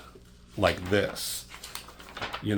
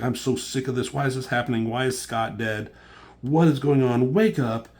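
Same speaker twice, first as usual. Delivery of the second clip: acted-out angry rant building to a yell; retold yelling; 4 semitones higher, 4 dB louder than usual. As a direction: rant higher, ending in a loud mock yell. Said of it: "She's just yelling at this motherfucker".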